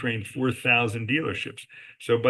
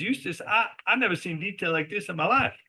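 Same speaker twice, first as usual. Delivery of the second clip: Southern accent impression; 8 semitones higher than usual. Telling the podcast host how the billionaire buyer praised the house, in a high imitated Southern accent. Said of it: "he says in that accent".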